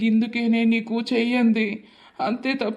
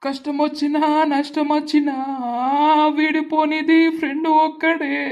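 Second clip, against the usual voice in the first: recited verse; 4 semitones higher than usual. Acted. Continues the friendship verse.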